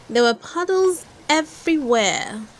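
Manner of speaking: ordinary delivery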